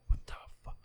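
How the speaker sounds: whispered disbelief, voice dropped right away; hushed, awestruck aside; stunned whisper. Retold or imitated. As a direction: whisper it, floored by what you heard. Stunned reaction to the song heard.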